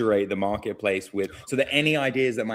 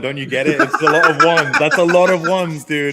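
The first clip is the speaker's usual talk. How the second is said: louder, higher, hammed-up amazement; mock excited believer; 6 semitones higher, 9 dB louder than usual. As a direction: play the dazzled stoner believer. Mocking numerology believer.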